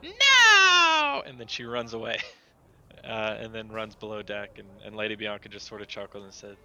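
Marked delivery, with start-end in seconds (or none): 0.0-1.2 very high, loud squealed refusal; defiant little critter. Acted. Critter told to be good, defiant shriek. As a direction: shriek it like a stubborn little child.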